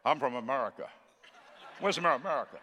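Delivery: mock American drawn sideways; sideways American accent. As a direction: imitate Americans talking sideways. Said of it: "Americans talk sideways".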